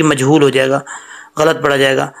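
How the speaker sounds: ordinary delivery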